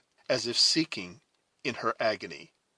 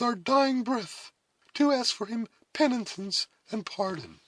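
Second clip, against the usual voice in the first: high, loud, earnest woman's voice; resolute heroine; 9 dB louder than usual. A narrator's reading. Cora excusing the savage before death, resolute and high. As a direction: plead loftily, pitched well up.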